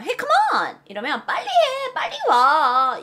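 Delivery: exasperated calling to a dawdler; impatient urging. Acted. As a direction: hurry someone along, stretching the end. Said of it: "이런 표현도 되고".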